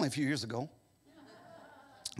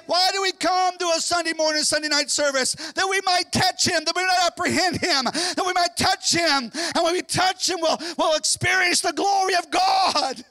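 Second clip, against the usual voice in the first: loud, high, ringing sermon voice; fervent revival preaching; full-force preaching; 12 semitones higher, 6 dB louder than usual. Acted. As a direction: preach it out to the whole church.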